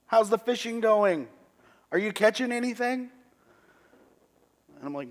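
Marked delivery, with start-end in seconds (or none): 0.1-3.1 even higher, louder teasing call from afar; calling from the shore. Retold or imitated. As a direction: shout it playfully across the water. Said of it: "I hear a voice from the shore say".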